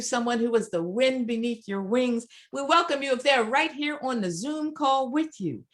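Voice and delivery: ordinary talk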